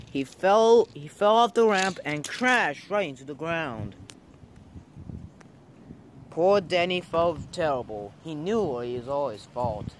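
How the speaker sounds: ordinary delivery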